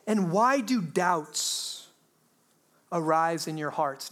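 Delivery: drawn-out, deliberate reading of the question; slow scripture reading. Shown as a demonstration. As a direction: let the question hang slowly. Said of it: "Now, that is a great question".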